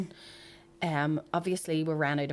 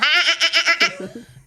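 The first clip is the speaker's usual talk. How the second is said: high, loud mock cackle; witch's cackle; 10 semitones higher, 10 dB louder than usual. Imitating a witch's laugh to mock the name, cackling. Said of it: "a witch's laugh".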